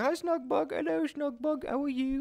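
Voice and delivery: put-on high, soft baby-talk hello; softly squeaked singsong greeting; cutesy greeting. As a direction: coo it high and small, like to a little pet. Cooing hello at a viewer's character.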